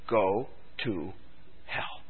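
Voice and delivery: low, soft and solemn; quiet voice of God. Acted. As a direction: let it drop to a low hush. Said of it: "God will say".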